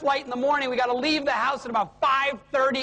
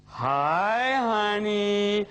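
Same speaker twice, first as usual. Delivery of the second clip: drawn-out, lowered sweet-talk greeting; syrupy husband on phone; 4 semitones lower, much slower than usual. Retold or imitated. Husband phones the wife who expects no call, hammed-up drawn-out greeting. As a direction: stretch out a hammed-up loving hello. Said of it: "The conversation goes like this".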